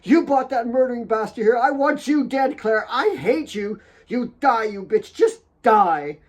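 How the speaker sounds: very high, loud screaming rage; furious scream. Acted. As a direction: scream her out of the room. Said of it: "threw another ornament".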